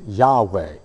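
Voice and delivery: Yahweh said slowly as yah-weh, stress on the first syllable; careful pronunciation. Shown as a demonstration. Preacher shows the congregation the divine name: careful Yahweh. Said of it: "if you tried to pronounce it".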